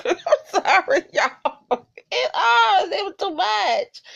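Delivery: giggly, half-crying with laughter; laughing apology. Real, not acted. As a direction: laugh through the words, can't hold it in. Laughing at own disgust.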